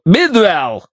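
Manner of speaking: high, sharp bark of a name. A narrator's reading. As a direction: snap the name out like a whip. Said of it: "рявкнул Джонстон".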